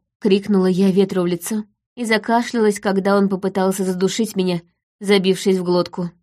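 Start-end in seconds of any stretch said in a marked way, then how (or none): none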